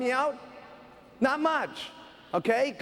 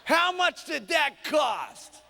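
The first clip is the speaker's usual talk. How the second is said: slow, higher heavy demand; negative husband; 5 semitones higher, slower than usual. Acted. Husband demands the price from wife, accusing. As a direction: punch out each word, accusing. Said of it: "her big, fat, negative husband".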